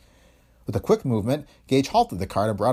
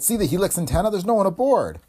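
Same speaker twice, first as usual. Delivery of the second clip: high, urgent exclamation; excited cry; 9 semitones higher than usual. A narrator's reading. Scientist pointing out the empty plane to colleague, excited cry. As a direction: call out the startling find, pitched high. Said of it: "he cried".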